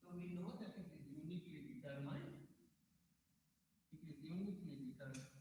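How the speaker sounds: faint, distant low-volume mic; barely audible. Real, not acted. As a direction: voice fading out on a dying mic. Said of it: "Sir, your voice is very low".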